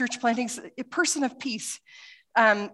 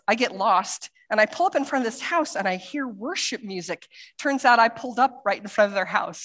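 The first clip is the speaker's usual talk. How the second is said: ordinary delivery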